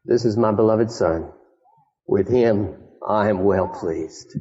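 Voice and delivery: low, lightly voiced voice of God; measured, solemn hint of God speaking, slowing at the close; voice from heaven. God declaring over his beloved Son, lightly voiced heavenly voice. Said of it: "a voice from heaven saying".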